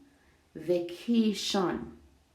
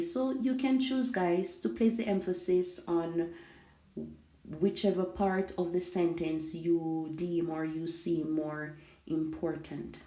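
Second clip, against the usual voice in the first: ordinary talk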